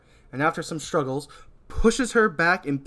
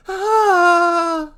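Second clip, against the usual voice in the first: high, loud terrified scream; big scream; 10 dB louder than usual. Acted. Donna screaming on seeing Michael. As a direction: shriek in terror. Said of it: "lets out a big scream".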